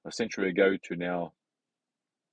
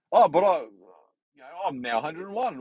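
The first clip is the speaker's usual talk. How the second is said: put-on old person voice; 8 semitones higher, 3 dB louder than usual. Quoting a centenarian.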